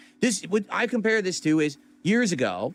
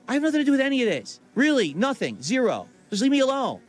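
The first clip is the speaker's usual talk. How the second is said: higher, fast, louder, fed-up protest; exasperated brush-off; 7 semitones higher, 3 dB louder than usual. Retold or imitated. Telling people reaching out to leave him alone, exasperated and fast. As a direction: rattle it off like you're sick of being asked. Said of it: "And I'm like".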